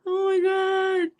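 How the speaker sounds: high, slow, wheezy exclamation; overcome with laughter. Real, not acted. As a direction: squeal it out at the end of a laughing fit. Recovering from a laughing fit.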